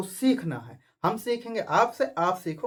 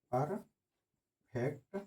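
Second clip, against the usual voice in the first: slow dictation; 5 semitones lower, 10 dB quieter, much slower than usual. Shown as a demonstration. Teacher dictates to students writing notes, very slow. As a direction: stretch perfect out very slowly.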